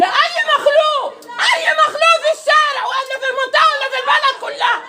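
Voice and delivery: yelling defiantly during a street quarrel; real shout. Real, not acted. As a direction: shout it at the whole neighbourhood.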